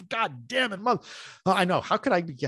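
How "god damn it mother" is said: a mock-furious curse, pitched higher; half-hammed frustration at the screen; retold angry curse. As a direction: spit it out like the deal just slipped away again. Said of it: "And I was pissed".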